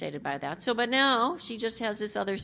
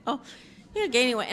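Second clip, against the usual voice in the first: laughing self-mockery; 6 semitones higher, faster than usual. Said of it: "look and say".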